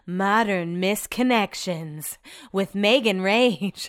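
slow announcer-style title read; voiceover intro. Shown as a demonstration. Podcaster trying out her own show intro, slow announcer read. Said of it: "getting another voiceover".

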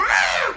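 high-pitched mock scream; sudden high horror-movie shriek; imitated movie scream. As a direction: scream like a horror-film victim. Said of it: "que la gente grita".

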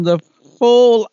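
sudden loud, high jab of a word; mock scolding cry of fool; exasperated outburst. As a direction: throw the word out in mock disbelief. Exasperated at poison-drinking character.

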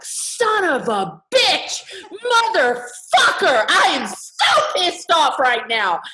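put-on high, slow, gleeful five-year-old swearing; five-year-old cursing. Little girl curses at the class when the record player breaks, high and gleeful. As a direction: let loose like a delighted kid cursing. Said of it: "And I was like".

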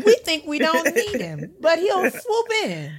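high laughing church testimony; preacher voice. Acted. Mock preaching continues, laughing.

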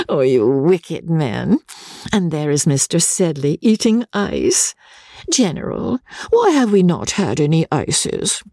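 high, coquettish lady's voice; tease, then demand prettily in a high voice; high-pitched flirtatious chatter; playful Rebecca. Acted.

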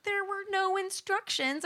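high, slow helpless whine; whiny protest. Retold or imitated. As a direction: plead like a lost kid. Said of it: "I was like".

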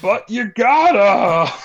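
put-on loud, high, slow drunken whine; acting drunk. Drunk friend begs for a ride, exaggerated drunken wail. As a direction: plead like a hammed-up drunk, stretching every word. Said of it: "Academy Award for acting, like, drunk".

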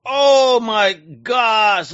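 drawn-out, loud and high; excited gasp. Real, not acted. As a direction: gasp it out in delight. Host sees the commission tier.

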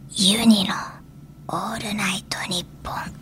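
soft unvoiced whisper. Shown as a demonstration. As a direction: whisper the title gently. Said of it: "ささやき声でお知らせ".

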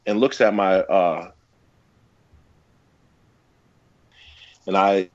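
breaking up on a bad connection; choppy cut off endings. Real, not acted. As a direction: audio dropping out mid-sentence. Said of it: "Oh, you're cutting out".

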